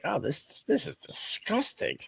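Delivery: high, drawn-out mock disgust. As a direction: stretch it out like a kid recoiling from a taste. Acting out first taste of coffee.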